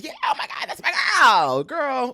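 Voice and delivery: very high squeal rising to a shout; mock gushing. Imitating naive past self on Hollywood friends, gushing.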